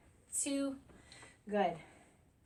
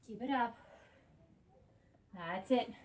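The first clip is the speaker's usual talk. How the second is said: ordinary talk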